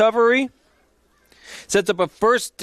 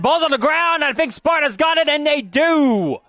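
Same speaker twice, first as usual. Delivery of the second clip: rising excitement, higher and quicker; excited call; 6 semitones higher than usual. Real, not acted. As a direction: call the fumble as it happens. Fumble recovered live on air.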